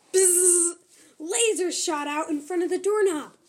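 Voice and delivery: usual speaking voice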